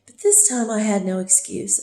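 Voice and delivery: normal talking voice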